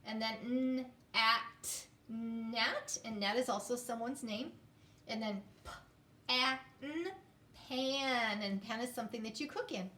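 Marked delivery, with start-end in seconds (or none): 0.4-3.0 n, at, nat split then blended; sounding out. Shown as a demonstration. Teacher sounding out nat. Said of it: "let's do the last three". 5.6-8.4 p, a, n, pan split then blended; sounding out. Shown as a demonstration. Teacher sounding out pan. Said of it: "let's do the last three".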